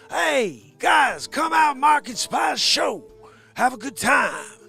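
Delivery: high, loud shouting Pacino; Al Pacino impression. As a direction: bellow the invite like Pacino hyping a crowd. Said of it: "Or just regular Al Pacino".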